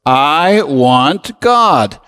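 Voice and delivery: I want God said very slowly, each word weighed; slow, drawn-out declaration. Shown as a demonstration. Preacher leading the congregation in a slow declaration. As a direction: lead the room through I want God at a slow pace. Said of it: "Say it with me".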